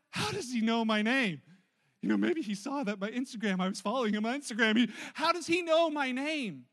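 loud, disbelieving fan; stunned fan asking how a celebrity knows her name; amazed. Acted. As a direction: gush in loud wonder. Acted amazed fan reaction.

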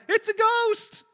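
high, loud panicked cry of fear; terrified cry. Retold or imitated. Frightened disciples in the boat cry out, high and loud. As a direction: shriek it like you've seen a ghost. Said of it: "they cried out".

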